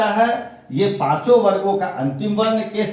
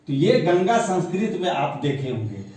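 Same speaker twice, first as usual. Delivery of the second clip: ordinary talk, nothing marked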